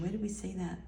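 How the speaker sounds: ordinary delivery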